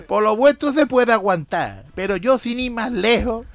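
cada vez más alto y agudo, hasta el clímax; remate del chiste. Acted. Chiste, tercer amigo, remate. (remate exagerado, cada vez más fuerte)

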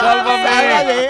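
teasing mock cry for rescue; mock plea. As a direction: play the damsel begging to be saved.

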